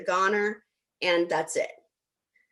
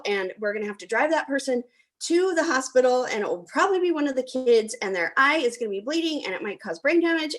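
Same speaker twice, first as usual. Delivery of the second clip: higher, rushed catastrophizing; panicked brother; 5 semitones higher than usual. Retold or imitated. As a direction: spiral into worst cases. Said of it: "He's like".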